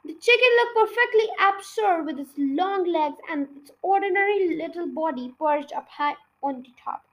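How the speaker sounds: ordinary delivery, nothing marked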